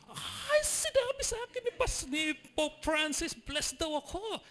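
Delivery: a squealing imitated believer; excited fan. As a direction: gush like a thrilled fan.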